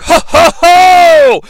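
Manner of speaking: big, loud mock Santa laugh; jolly laugh. Lebowski arrives with toys, loud hammed-up jolly laugh. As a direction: boom it high and drawn out. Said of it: "he does have a jolly laugh".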